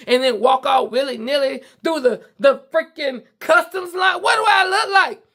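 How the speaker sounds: high, loud, outraged shout; real indignation, shouted high and loud; indignant shout. Real, not acted. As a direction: throw the question out in real disbelief. Ranting about being profiled.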